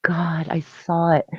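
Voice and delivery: airy, whispered retold gasp; half-whispered fearful quote; hushed dread. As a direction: whisper it softly, awestruck at the sight. Retold fear, barely voiced.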